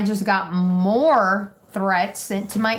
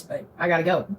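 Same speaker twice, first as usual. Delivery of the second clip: crying; 7 semitones lower than usual. Real, not acted. Breaking down over arrest.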